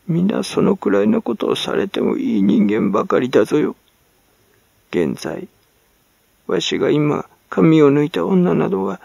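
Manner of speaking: high, cracked crone voice, halting and mumbled; mumbling old hag. Acted. Old woman excusing herself to the servant, high crone mumble. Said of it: "引きのつぶやくような声で、口ごもりながら".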